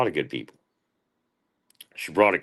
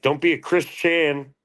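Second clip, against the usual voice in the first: high, slow mocking voice; 11 semitones higher, 4 dB louder, slower than usual. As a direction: jeer in a silly high voice. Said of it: "I was making fun of".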